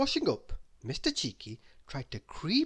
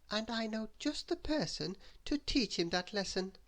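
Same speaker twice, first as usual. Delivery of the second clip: high-pitched prim story-character voice; high, measured little voice; Little Miss Neat; 8 semitones higher than usual. A narrator's reading. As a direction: voice a small character, pitched well up. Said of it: "said Little Miss Neat".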